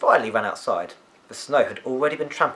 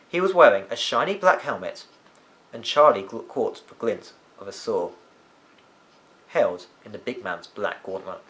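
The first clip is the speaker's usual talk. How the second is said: plain reading voice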